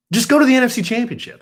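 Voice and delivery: high, fast pleading; exasperated plea. Real, not acted.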